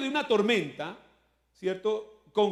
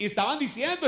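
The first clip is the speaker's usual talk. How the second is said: ordinary talk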